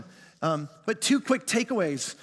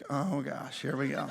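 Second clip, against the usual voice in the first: softer, resigned under the breath; resigned mutter; 8 semitones lower, 9 dB quieter than usual. Retold or imitated. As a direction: brace for trouble, quietly. Bracing for wife's answer.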